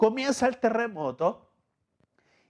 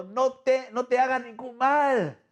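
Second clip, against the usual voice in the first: higher pitched urgent call; 6 semitones higher than usual. Acted. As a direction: call out to stop the jailer. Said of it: "le grita a Pablo, clamó ahora en voz". (Pablo le grita al carcelero, agudo y urgente)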